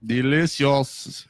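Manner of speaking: slow, savoring delicious; drawn out. Acted. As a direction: stretch the word out. Drawn-out ad word.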